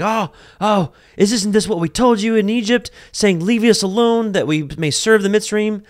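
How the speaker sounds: high, loud whiny mock panic; freaking out. Frightened people blaming Moses, whiny mock panic. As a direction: complain in a frantic rush. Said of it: "freaking out".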